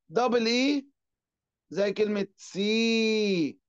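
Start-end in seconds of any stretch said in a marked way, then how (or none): 2.4-3.6 سي as a long, held "see". Shown as a demonstration. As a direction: stretch the "ee" of سي. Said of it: "أنا بمد في الإي". (مدّ الإي في «سي»)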